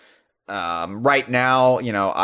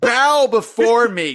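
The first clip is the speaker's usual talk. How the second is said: booming slow decree, higher and louder; dark lord; 12 semitones higher, 5 dB louder, slower than usual. Acted. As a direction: proclaim it like a god, laughing through it. Mock command to his co-host.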